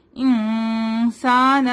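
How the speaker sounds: انسان with the noon hidden, nasalised and drawn out slowly. Shown as a demonstration. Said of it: "نون کی آواز کو چھپاتے ہیں". (انسان میں نون کا اخفا)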